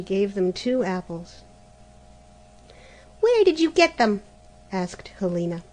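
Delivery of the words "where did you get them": high, loud demanding question; greedy sister. Acted. Helena demanding to know about the apples. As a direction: snap the question out.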